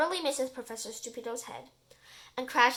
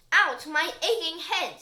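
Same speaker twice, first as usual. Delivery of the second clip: pained professor; 4 semitones higher, 7 dB louder, slower than usual. Acted. Professor wakes up hurt.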